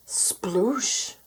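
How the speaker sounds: whispery, drawn-out splash; splash sound. A narrator's reading. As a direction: imitate a splash on the breath. Imitates landing in the pond.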